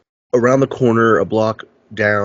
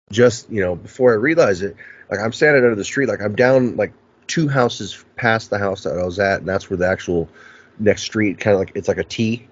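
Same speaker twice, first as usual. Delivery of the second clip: normal talking voice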